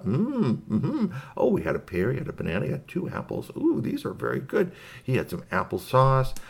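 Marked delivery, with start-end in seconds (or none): 0.0-1.1 high-pitched yummy hum; pleased high mm-hmm; savouring hum. Acted. Alien relishes the fruit. 3.6-4.7 very high, pleased alien voice; high-pitched relishing space creature; delighted alien. Acted.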